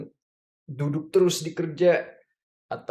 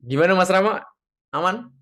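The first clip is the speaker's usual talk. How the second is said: calling out; 6 semitones higher, 5 dB louder, much faster than usual. Real, not acted. Calling to an online participant.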